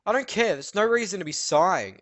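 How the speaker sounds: scolding teacher, hammed up; mock stern teacher. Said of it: "And she's like".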